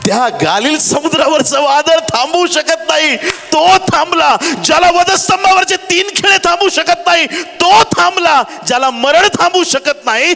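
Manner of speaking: high-pitched, driving revival preaching; fervent preacher at full cry; full-force preaching. Real, not acted. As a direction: proclaim it in ringing, sustained high phrases.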